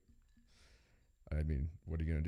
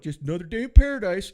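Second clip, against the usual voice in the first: much higher, louder sardonic quip; mock coworker catchphrase; 13 semitones higher, 12 dB louder than usual. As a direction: deliver the tired catchphrase with fake cheer. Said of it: "He'd go".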